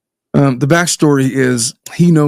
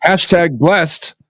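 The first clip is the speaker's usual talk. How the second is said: slow, drawn-out, higher proclamation; mock-triumphant; 4 semitones higher, 3 dB louder, much slower than usual. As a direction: draw both words out grandly. Mock bragging line read aloud.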